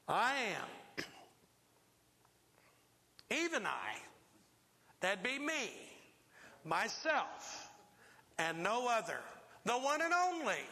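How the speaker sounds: "I am", "Even I", "myself" each set apart by pauses; emphatic. Acted. Preacher voices Jesus declaring who he is, emphatic. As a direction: land each phrase firmly, building to "The one and only". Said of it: "this is emphatic what Jesus is saying".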